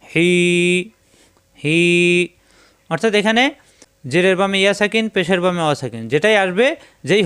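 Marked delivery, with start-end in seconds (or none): 0.0-1.8 হি pressed from the throat, ee held one alif; elongated syllable. Shown as a demonstration. Teacher shows students হি lengthened from the throat. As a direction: stretch হি into a long throaty hee. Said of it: "এই হিকে লম্বা করতে হবে".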